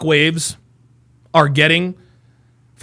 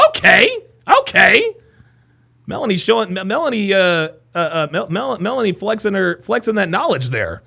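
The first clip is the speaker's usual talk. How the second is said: ordinary delivery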